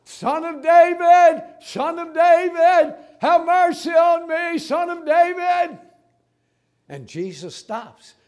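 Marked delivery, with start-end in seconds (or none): 0.0-5.8 loud, high, drawn-out shout; crying out. Acted. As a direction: call out for mercy at full voice. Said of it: "he's crying out".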